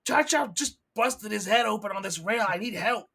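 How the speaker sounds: acted urgent plea over comms; calling for help. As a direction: call out to the missing crew. Gus calls for help on comms.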